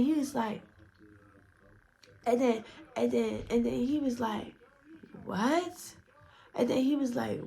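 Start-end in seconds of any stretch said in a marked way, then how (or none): 5.3-5.9 high, rising question; imitated incredulous reaction; baffled what. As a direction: pitch it up in mock bewilderment. Acting out his baffled reaction.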